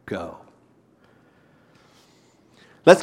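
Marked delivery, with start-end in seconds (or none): 0.1-0.4 much lower and softer Go; hushed personal command. Acted. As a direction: say Go. quietly, straight to each listener. Command applied to listeners quietly.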